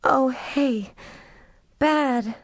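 high, frightened voice. A narrator's reading. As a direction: shaken at the sight. Horror at the severed head.

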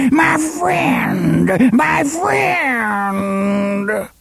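very high, long drawn-out keening; wail of grief and rage. A narrator's reading. Woman mourns her slain snake, wailing in grief and rage. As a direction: wail it high, stretching out the last word. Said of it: "a wail of grief and rage so awful".

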